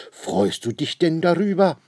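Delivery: high, loud mock-tearful question; sobbing. A narrator's reading. Lady asks the delighted man, mock sobbing. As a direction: ask it in a high, acted wail. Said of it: "schluchzt die Dame".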